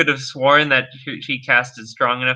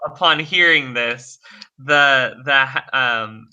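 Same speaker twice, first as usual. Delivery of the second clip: normal talking voice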